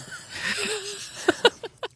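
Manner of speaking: high laugh. Real, not acted. Laughing at her lie joke.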